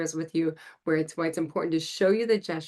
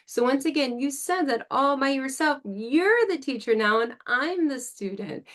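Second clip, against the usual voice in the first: excited praise; 6 semitones higher than usual. Shown as a demonstration. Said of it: "praising child".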